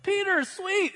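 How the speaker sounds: excited squeal. Retold or imitated. Rhoda hearing Peter at the gate, excited squeal. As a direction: squeal with delight at the door.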